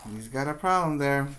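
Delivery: ordinary delivery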